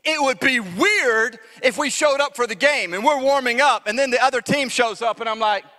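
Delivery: ordinary talk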